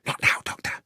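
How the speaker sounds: short hushed whisper. Acted. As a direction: brush him off under your breath. Lestrade whispering in hiding.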